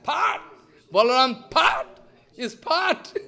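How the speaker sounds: high sharp mock slap sounds; high loud mock slap; slap noise. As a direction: smack the word out.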